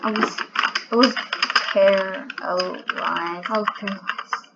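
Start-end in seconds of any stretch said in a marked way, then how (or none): 1.7-4.5 slow, lower, fumbled "paralyzed"; struggling to pronounce. Real, not acted. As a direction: stumble over "paralyzed", dragging the syllables out. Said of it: "I can't even say it".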